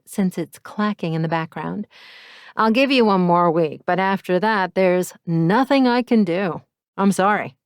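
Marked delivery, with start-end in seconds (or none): 2.6-7.6 louder, firm male landlord voice; landlord on the phone. Acted. Landlord refusing more time, firm and louder. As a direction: landlord giving a final warning.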